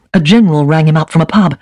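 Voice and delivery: ordinary delivery, nothing marked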